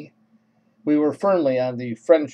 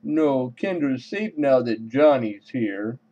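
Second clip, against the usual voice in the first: man-talking-to-dog voice. Acted. Man reassuring little Kendra she is safe, dog-talk voice. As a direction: coo it like a man babying his puppy. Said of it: "my man talking to dog voice".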